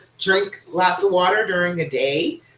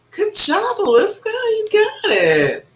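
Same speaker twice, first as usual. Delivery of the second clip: high, singsong congratulations; delighted squeal, drawn out at the end; excited praise; 4 dB louder, slower than usual. Real, not acted. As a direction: cheer her on with voice way up. Cheering on a viewer's progress.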